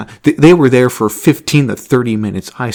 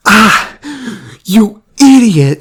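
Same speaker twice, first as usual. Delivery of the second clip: high, loud, slow yell of frustration; angry outburst; 10 semitones higher, 6 dB louder, much slower than usual. Retold or imitated. Kid hit in the face by ball, yelling angrily at the worker. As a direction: bark it out, stung and furious. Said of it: "hits him in the face and goes".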